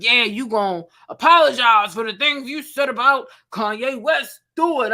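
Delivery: aggressive energy. Pretend. Vlogger imagining an aggressive confrontation with D L Hughley, mock tough. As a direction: pretend to confront him hard. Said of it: "you would have thought ... aggressive energy".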